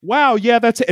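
high, loud breathless wonder; a mock-enlightened gasp, high and loud; awestruck agreement. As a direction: gush like you just saw the light. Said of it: "turned to be like".

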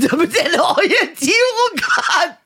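high, slow, laughing mimicry; laughing mockery.

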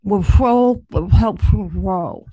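talking through a mouthful of stuffing; loud, slow words round a full mouth. Pretend. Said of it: "as if your mouth was full of stuffing".

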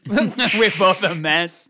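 loud, slow words through real laughter; high-pitched, laughing outburst. Real, not acted. Podcast host admitting both are a mess, laughing. Laughs at their shared slip.

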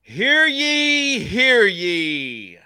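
booming town crier call; stern archaic proclamation. Acted. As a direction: proclaim it like a herald. Town crier style intro proclamation.

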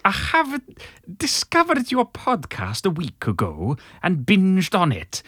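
put-on sing-song Italian English with a Welsh accent. Reading a listener's letter to Magenta in a Welsh Italian accent. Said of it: "do it in an Italian Welsh accent".

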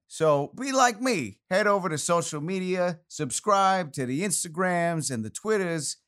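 lightly voiced retired soldier; a hint of a retired grenadier; character voice. Outro in Widget character.

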